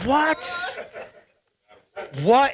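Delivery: high-pitched amazed what, twice; retelling his shock at the trick; stunned disbelief. Retold or imitated. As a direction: gasp it out like your mind is blown. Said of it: "and I'm there like".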